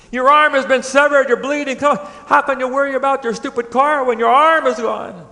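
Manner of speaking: high-pitched exasperated disbelief; incredulous scolding. Acted. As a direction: scold someone with mock astonishment. Acted bystander scolding a crash victim.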